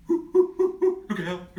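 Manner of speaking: loud, high, laughing whoop; whooping chimp. Acted. Chimpanzee whooping a warning at the elephant. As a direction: whoop it like a monkey. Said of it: "whooped the chimpanzee".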